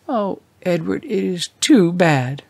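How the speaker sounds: tearful wife despairing over the checks; near tears. A narrator's reading. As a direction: let it crumble into crying. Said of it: "began to cry".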